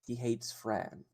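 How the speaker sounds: calm her down gently; tender comfort. Acted. [name] comforting the character.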